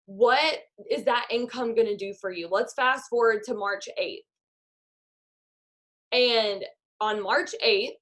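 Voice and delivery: walk them through it like a meditation; guided visualization. Acted. Guided visualization over Zoom.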